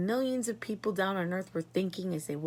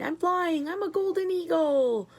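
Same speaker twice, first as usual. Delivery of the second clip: high, loud delighted cry; thrilled; 10 semitones higher, 5 dB louder than usual. A narrator's reading. Grandma Josephine thrilled to be flying, high and loud. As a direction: crow with joy like a child.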